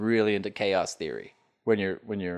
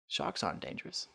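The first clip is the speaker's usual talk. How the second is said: deadpan whisper; 4 semitones lower, 11 dB quieter than usual. Acted. Deadpan absurd claim.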